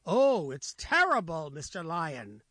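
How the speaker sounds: blunt jaguar. Acted. Jaguar answers lion honestly.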